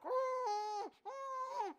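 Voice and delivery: high, drawn-out muffled "oh" cries. Retold or imitated. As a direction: imitate a captive's muffled wailing. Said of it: "You hear muffled, muffled sounds like".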